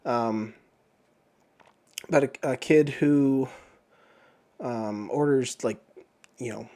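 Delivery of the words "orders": normal talking voice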